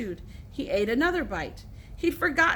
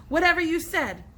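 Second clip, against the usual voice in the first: shouted retort; 6 semitones higher, 5 dB louder than usual. A narrator's reading. Heated argument.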